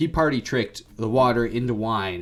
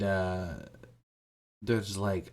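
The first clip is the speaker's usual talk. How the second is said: usual speaking voice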